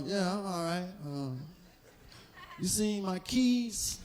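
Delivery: low-key pretend nonchalance; mock breezy small talk; fake casual. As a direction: act like nothing's wrong. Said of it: "Right, you be".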